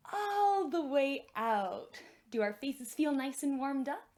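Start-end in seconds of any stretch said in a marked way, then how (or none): 0.1-2.0 slow, stretched big-smile voice; smiling wide. Shown as a demonstration. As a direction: say it through a huge grin. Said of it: "give a big smile so my face stretches".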